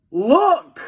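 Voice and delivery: high, loud warning shout; boy spotting a steamroller; alarm shout. A narrator's reading. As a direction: shout it up at the sky. Pointing upward.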